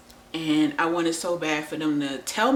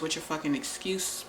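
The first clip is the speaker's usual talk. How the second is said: ordinary talk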